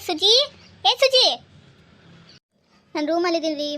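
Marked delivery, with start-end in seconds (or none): 0.1-0.3 a first searching call, rising at the end. Acted. Husband calling for his wife. 0.9-1.2 loud, high-pitched holler for his wife; shouted call. Acted. Husband calling again, much louder.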